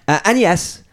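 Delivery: usual speaking voice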